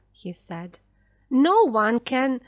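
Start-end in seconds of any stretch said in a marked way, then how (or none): 1.3-2.4 loud, broken-off protest; exasperated. A narrator's reading. Daniel arguing with the frantic mother, exasperated. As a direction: protest loudly until cut off. Man despairing, cut off by mother.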